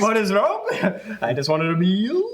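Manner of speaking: words broken by laughs; laughing. Retold or imitated. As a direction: laugh through the quote. Laughing while quoting.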